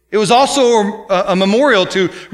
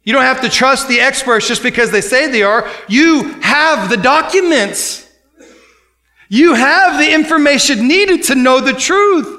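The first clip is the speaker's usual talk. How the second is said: fiery preaching; 5 semitones higher than usual. Acted. Preacher urging the church to search scripture, fiery exhortation. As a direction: drive it home to the congregation.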